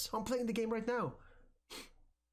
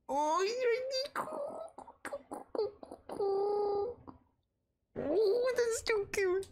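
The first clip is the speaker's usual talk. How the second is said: high sweet pet voice; slower than usual. Real, not acted. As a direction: coo at a tiny pet. Cooing over pet Bunny.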